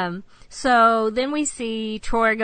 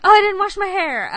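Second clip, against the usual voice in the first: high, loud excuse through laughter; mock-flustered excuse; 10 semitones higher, 8 dB louder than usual. Friend making an excuse to leave the crush alone, mock-flustered. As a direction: giggly pretend panic.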